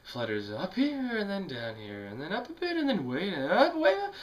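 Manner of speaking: roller-coaster pitch, ending in a high squeak; voice tracing the melody. Shown as a demonstration. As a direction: pitch swoops up, dips down, then climbs very high. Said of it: "How the melody doesn't really sit still".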